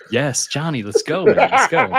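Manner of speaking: mock hype. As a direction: pump him up through laughter.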